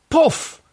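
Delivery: high, sharp poof; magic poof. Acted. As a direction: pop it out like magic smoke. Genie appears in joke.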